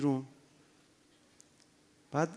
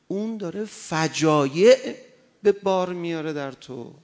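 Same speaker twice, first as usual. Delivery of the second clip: loud, high, rising sermon warning; fiery preaching; 7 semitones higher, 8 dB louder than usual. Acted. Preacher warning his audience off the singer, preaching hard. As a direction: thunder at the congregation from the pulpit. Preacher warns listeners about music.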